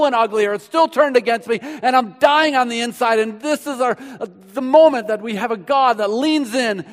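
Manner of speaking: loud, high, impassioned preaching; full-force sermon climb. Acted. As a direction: lift the room with it. Preaching peak on Jesus' words.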